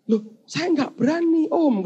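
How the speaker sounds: high-pitched defensive protest; meek protest. Acted. Angel denying he changed the order, high meek protest. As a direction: protest innocence to an elder.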